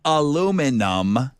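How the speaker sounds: over enunciating. Shown as a demonstration. Repeats slower for lip reading.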